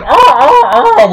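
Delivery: high, loud repeated monkey alarm cries; monkey alarm call. Retold or imitated. Teacher imitating a vervet monkey's alarm call, high and loud. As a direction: shriek the warning like a vervet monkey. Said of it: "He's going".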